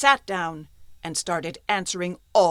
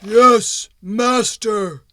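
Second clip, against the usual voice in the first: loud, growled stone golem voice; growling golem; 11 dB louder, much slower than usual. Acted. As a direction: growl it like a lumbering stone creature. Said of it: "growled the golem".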